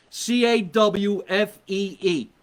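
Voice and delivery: C A W F E E, each letter slow and apart; spelled out slowly. Shown as a demonstration. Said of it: "that's the way you spell it".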